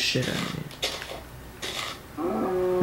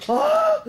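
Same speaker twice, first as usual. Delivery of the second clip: high startled shriek; startled scream; 4 dB louder than usual. Real, not acted. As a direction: shriek in fright. Attacked again.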